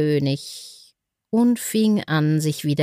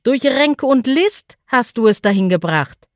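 angry birds; 7 semitones higher, 4 dB louder than usual. A narrator's reading. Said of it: "schrien die Vögel zornig".

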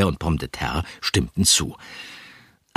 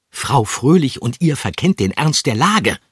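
higher, louder agitated call; agitated shout; 7 semitones higher, 4 dB louder than usual. A narrator's reading. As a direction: cry it out, worked up. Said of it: "rief er aufgebracht".

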